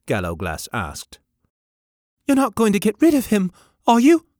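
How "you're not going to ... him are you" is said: put-on high, fast, loud plea of a heartbroken boy. A narrator's reading. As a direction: beg not to lose the dog, near tears. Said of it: "in heartbroken tones".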